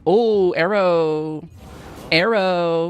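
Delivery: put-on drawn-out valley girl "Oh, arrow". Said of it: "Why did you turn into a valley girl".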